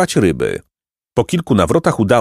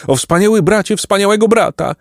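high-pitched wailing pelican; moaning lament; 12 semitones higher than usual. A narrator's reading. A pelican bemoaning its brother, moaning. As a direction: wail like a woeful bird. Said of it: "jęczał jeden z nich".

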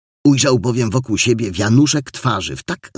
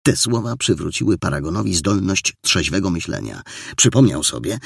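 plain reading voice

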